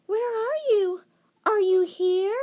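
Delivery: put-on high girlish voice calling out; scared calling. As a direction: call into the dark, high and worried. Scared girl calling in dark.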